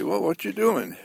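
high curious caller. Retold or imitated. Caller phones Walter Mitty asking, voiced high and curious. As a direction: ask it like a nosy friend, pitched high.

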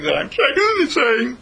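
high, very slow, mock-wounded; teasing drawl. Teacher teasing an inattentive student, slow and high. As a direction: stretch it out in playful complaint.

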